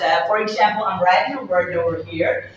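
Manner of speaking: normal talking voice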